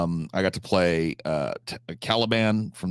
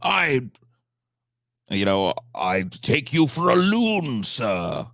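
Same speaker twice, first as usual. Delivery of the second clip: put-on high, slow, theatrical old-stage delivery with far higher pitch; mock Shakespearean actor; 7 semitones higher, much slower than usual. Shown as a demonstration. Streamer mocking stuffy Shakespeare delivery to chat, hammed-up theatrical. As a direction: ham up an old-fashioned Shakespeare actor, lofty and drawn out. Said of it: "You don't have to sound like this".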